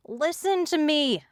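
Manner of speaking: high, urgent yell. A narrator's reading. Captive yells at her captors to listen. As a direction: cut through the struggle with a yell. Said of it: "I yelled".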